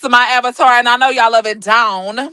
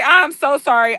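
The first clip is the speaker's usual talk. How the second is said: ordinary delivery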